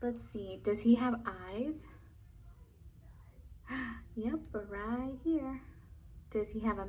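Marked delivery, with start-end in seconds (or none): none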